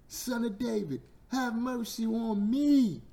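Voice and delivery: high, drawn-out cry for mercy; desperate blind beggar's scream; yelled plea. Retold or imitated. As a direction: yell it out long and high.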